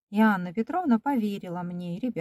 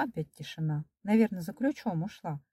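ordinary delivery, nothing marked

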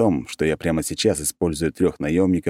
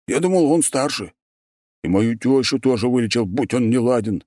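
annoyed bark; 6 semitones higher than usual. A narrator's reading. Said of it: "рявкнул раздосадованный голос".

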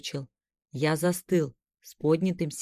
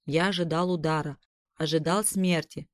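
usual speaking voice

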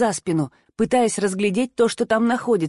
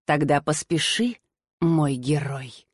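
soft, slow and low, intimate; tender intimate voice; 7 semitones lower, 3 dB quieter, slower than usual. A narrator's reading. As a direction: purr it tenderly to a lover. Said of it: "расцветая какой-то нежностью".

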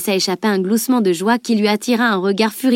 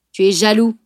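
retorting; 3 dB louder than usual. A narrator's reading. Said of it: "riposta le chevalier".

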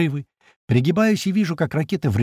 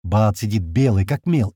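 usual speaking voice

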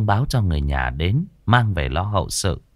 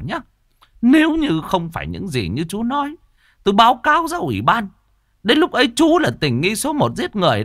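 higher, sneering official; mock him and warn him; smirking threat; 12 semitones higher than usual. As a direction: village chief sneering at the suspect. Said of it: "Ông nhếch môi".

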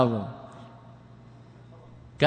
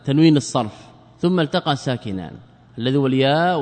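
ordinary talk, nothing marked

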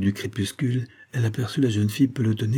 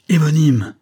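loud, high, angry cry; explosive exclamation, falling at the end; violent outcry; 7 semitones higher, 8 dB louder than usual. A narrator's reading. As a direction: hurl the name out furiously. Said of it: "s'écria-t-elle avec violence".

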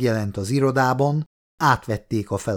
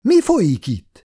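higher-pitched indignant outcry; an understated shout, pitched up; exclaimed demand; 8 semitones higher, 4 dB louder than usual. A narrator's reading. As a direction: call out sharply in a higher voice. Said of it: "egy kiáltás hangzott".

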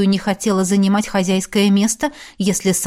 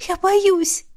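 put-on frightened girl; 9 semitones higher, slower than usual. Scared girl pleads.

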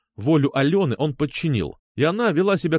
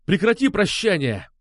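higher, angry snarled order; growled order; 7 semitones higher than usual. A narrator's reading. Сергей stops Алёна saying goodbye, growling. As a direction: cut her off with a growl. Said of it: "прорычал я".

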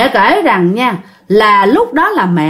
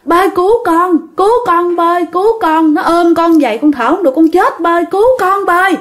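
higher, pleading cry for rescue; desperate plea; 7 semitones higher, faster than usual. Retold or imitated. Daughter crying out to her late father to save her, desperate. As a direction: beg your dead father to save you. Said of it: "mẹ kêu rằng á là".

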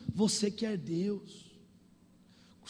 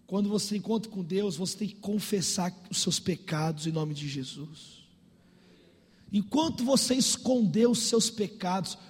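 ordinary talk, nothing marked

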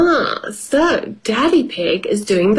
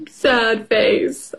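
ordinary delivery

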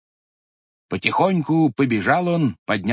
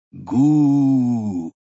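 booming rumble; 4 dB louder than usual. Acted. Stone rolling away sound.

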